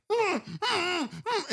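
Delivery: high-pitched mock muffled screams; hammed-up closed-mouth squeals; muffled screaming. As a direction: imitate screaming from inside a body bag. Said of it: "and screaming".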